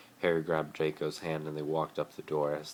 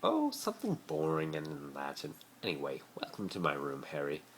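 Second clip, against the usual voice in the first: Draco's voice; 10 semitones higher than usual. A narrator's reading. Draco welcomes Harry to his room, airy. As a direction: brush it off lightly.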